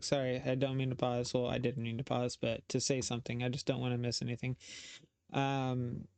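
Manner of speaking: usual speaking voice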